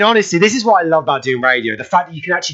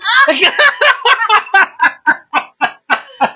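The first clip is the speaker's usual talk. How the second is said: big laugh. Real, not acted. Host cracking up at his own celebrity story, big laugh. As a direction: crack up helplessly.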